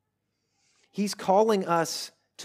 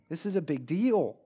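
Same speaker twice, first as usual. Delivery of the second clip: usual speaking voice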